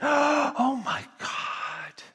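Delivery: high, slow, drawn-out gasp of awe; awed oh my God. Retold or imitated. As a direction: let it float up in wonder. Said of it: "she looked down and she went".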